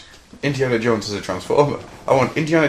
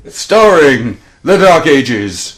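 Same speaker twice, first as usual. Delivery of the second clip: slow booming circus announcer; ringmaster; 4 semitones higher, 11 dB louder, much slower than usual. Pretend. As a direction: roll it out like a big top. Continuing the grand intro.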